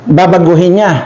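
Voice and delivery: ordinary talk, nothing marked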